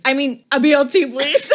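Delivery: high, loud flustered blurt; flustered exclaim. Acted. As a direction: blurt it out to cover up. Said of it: "Gwen exclaims".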